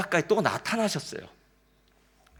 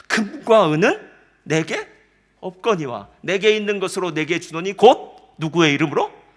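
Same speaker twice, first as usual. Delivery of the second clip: commanding proclamation; 3 semitones higher, 12 dB louder than usual. Retold or imitated. Peter commanding the lame beggar, loud and authoritative. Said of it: "이렇게 얘기한 거예요".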